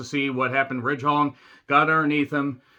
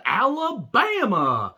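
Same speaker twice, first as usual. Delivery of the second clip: Alabama drawn out slow and high, falling at the end; stretched Alabama; 8 semitones higher, much slower than usual. Acted. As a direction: stretch out "Alabama" like a big announcer. Stretched show intro.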